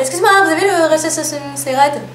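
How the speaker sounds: high-pitched retold request, tripping through "Relentlessly Red"; mock customer garbling "Relentlessly Red" in a tangle of syllables; stumbling over the name. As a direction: ask politely, then fumble "Relentlessly Red". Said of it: "Je suis allée voir la vendeuse".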